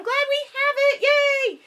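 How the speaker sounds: high, quick cheerful; high happy voice for the dogs; excited. Real, not acted.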